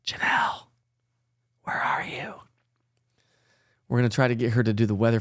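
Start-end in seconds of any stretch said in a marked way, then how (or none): 0.1-0.7 whispered call. Real, not acted. Calling softly to off-camera colleague. 1.6-2.3 whispered call. Real, not acted. Calling softly to off-camera colleague.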